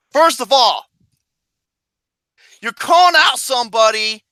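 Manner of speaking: fired-up rant at the UFC boss; angry rant. Real, not acted.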